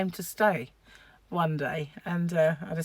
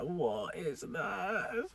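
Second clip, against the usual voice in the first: slow, soft, drawn-out question; retold creeped-out question; 10 dB quieter, much slower than usual. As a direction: stretch it out in hushed mock dread. Retells feeling something on leg.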